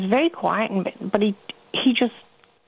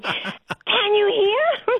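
put-on much higher and very slow childlike "hear"; slow, high toddler question; 13 semitones higher, much slower than usual. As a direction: ask nana slowly, face to face, like a toddler. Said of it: "she looked at me straight in the face".

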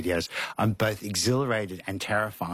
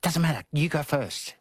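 Lizzie brushing off; 8 semitones higher than usual. Retold or imitated. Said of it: "She's like".

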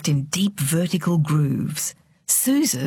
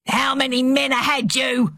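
high, raised interrogating voice; angry demand; 7 semitones higher than usual. A narrator's reading. Enraged father interrogating his silent daughter, angry demand. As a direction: demand it in a rage.

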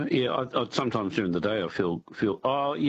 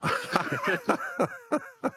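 high laugh. Real, not acted. Caller laughing at host joke.

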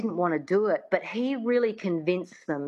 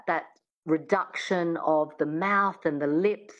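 normal talking voice

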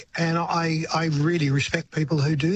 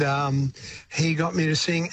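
usual speaking voice

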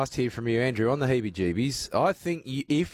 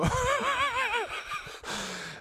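high laugh falling away; 3 dB quieter than usual. Real, not acted. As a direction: laugh it out, high then dropping. Host laughing at the song.

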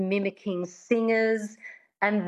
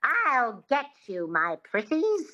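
slow, high witch twang; Wicked Witch; 5 semitones higher, slower than usual. Shown as a demonstration. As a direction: model a twangy witch threat. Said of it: "Think of the Wicked Witch".

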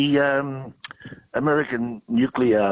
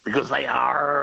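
pirate punchline; 3 semitones higher than usual. Pretend. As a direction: land the pun with a playful pirate growl on "are". Pirate pun punchline, played as arr.

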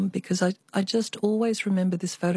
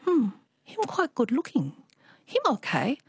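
put-on higher Chinese-accented mum, coy approval; 6 semitones higher than usual. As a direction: do mum's Chinese accent, a bit shy. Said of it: "I always have to do the Chinese accent".

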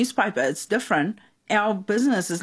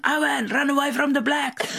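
mum calling out to the pitch, higher and pushed; retold yell; 6 semitones higher than usual. Mum cheering on her nephew at soccer, mock yell. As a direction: shout it across a soccer field. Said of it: "yelled at him".

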